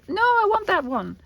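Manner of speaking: put-on high, loud childlike plea for one piece; pretend viewer begging. Vlogger voicing a viewer calling dibs, high pleading. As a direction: squeak it out like a viewer who wants that one. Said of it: "So, like".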